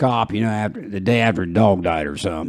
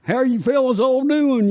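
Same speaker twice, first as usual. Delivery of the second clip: high, laughing hammed-up twang; thick country drawl; 13 semitones higher than usual. Imagined [name] greeting the guys, in a high hammed-up twang. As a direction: play a hokey country star. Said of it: "comes in and said".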